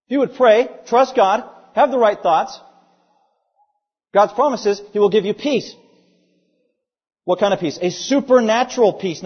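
loud, driving sermon delivery; forceful preaching. Real, not acted. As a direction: drive the promise home to the congregation.

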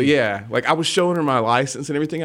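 normal talking voice

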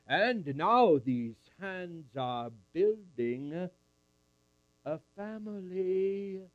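slow, stretched-out words; Anna savouring. Acted. Anna admires Joseph's working hands, slowly drawing out the words. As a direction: draw out each word with weight. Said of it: "She said".

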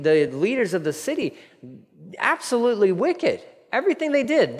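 ordinary talk

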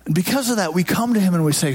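ordinary talk, nothing marked

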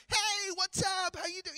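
hammed-up, very high-pitched hello; powered-up greeting. Pastor greeting a churchgoer in the grocery store, powered-up and gushing. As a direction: turn on the over-friendly charm, voice shooting way up. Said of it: "do you feel the need to, like, power up".

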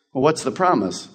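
ordinary talk, nothing marked

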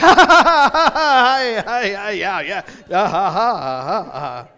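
imitated embarrassed laugh, bursting out loud then sinking; hammed-up nervous ha-ha, dying away; awkward laughing. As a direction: laugh because you don't know what else to do. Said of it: "I just started laughing".

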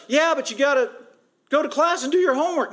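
high, pushy mock classmate voice; a lightly hammed-up scolding student; nagging classmate. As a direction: hound him to get to class. Classmates insist again.

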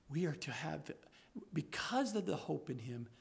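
soft, low, intimate sermon voice; hushed preaching. Acted. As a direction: draw them in close and quiet.

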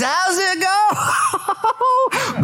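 very high, drawn-out sing-song call; hammed-up Jesus greeting. Jesus calling out to Peter from beach, very high drawn-out call. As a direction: stretch the go way out and up, playful. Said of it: "Jesus showed up and said".

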